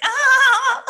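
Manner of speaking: put-on high, loud girlish squeal of delight. Carrie squealing with delight at the French guy. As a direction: let out a thrilled shriek. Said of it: "And she's like".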